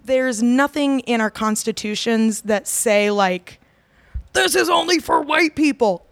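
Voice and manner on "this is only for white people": higher-pitched imitation of a blunt rule; mock official declaration, set apart from normal talk; mock proclamation. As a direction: voice an imagined decree, pitched up. Voices an imagined blunt constitution clause.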